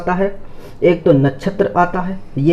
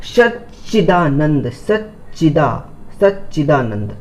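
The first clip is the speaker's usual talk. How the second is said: slow careful word; slower than usual. Shown as a demonstration. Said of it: "new word explained".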